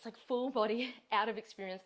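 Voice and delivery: usual speaking voice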